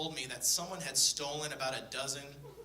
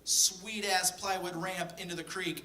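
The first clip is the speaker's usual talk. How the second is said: put-on bragging kid; 6 semitones higher, 6 dB louder than usual. Nine-year-old showing off to babysitter.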